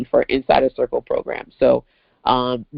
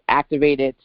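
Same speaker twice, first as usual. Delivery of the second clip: ordinary talk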